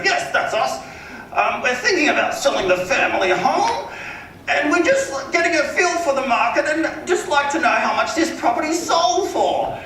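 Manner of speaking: very high, drawn-out rich man's voice; Philip Harding, wealthy caller. Retold or imitated. Wealthy homeowner asks agent about the market, high and affected. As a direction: mimic a wealthy homeowner's affected phone manner. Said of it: "wealthy people, like, they just have this".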